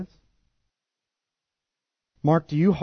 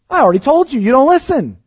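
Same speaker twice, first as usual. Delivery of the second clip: loud, high, exasperated retort; the healed man snapping back at the Pharisees, loud and high; defiant retort; 12 semitones higher, 10 dB louder than usual. Acted. Preacher voicing the healed man.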